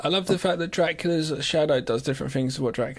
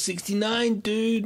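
mock kid; 8 semitones higher, much slower than usual. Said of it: "look at me and goes".

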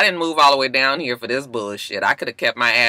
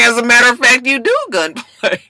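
sassy retort; 8 semitones higher, 4 dB louder than usual. Retold or imitated. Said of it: "I was like".

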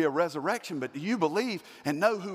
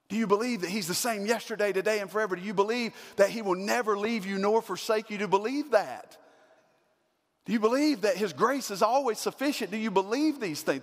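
louder, higher run of hammering questions; full-force preaching; 6 semitones higher, 4 dB louder than usual. Acted. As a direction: preach it, driving each question home.

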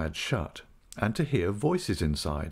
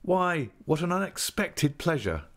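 high-pitched pleased exclamation; delighted surprise; 9 semitones higher than usual. A narrator's reading. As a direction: welcome an unexpected relative with delight. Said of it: "cried I".

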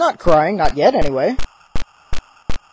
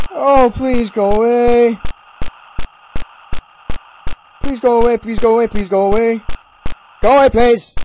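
raised, repeated desperate begging; frightened pleading; 3 semitones higher, 4 dB louder than usual. Real, not acted. Player pleading with the monster, frightened. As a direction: beg it over and over to leave.

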